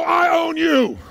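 high shouted comeback; like you mean it. Shown as a demonstration. Speaker demonstrates the rival's answer to the challenge, high shout. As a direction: shout back, topping the challenge. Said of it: "say it like you mean it".

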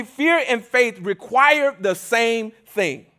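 slow, weighty delivery of fear and faith; deliberate teaching emphasis; slow emphatic. Real, not acted. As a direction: lay out each word slowly. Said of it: "here's what I want you to understand".

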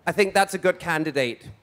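ordinary talk

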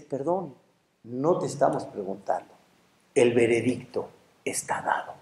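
low, hushed, implacable; final verdict. Acted. Angel telling the protesting man it is decided, quiet and final. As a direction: pronounce it very softly, pitched well down.

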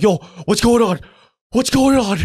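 asking strangers in a rising panic; panicked asking. Retold or imitated. At the party fence, panicked teen asking other kids what's happening. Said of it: "And I'm freaking out".

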